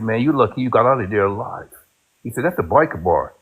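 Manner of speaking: ordinary talk, nothing marked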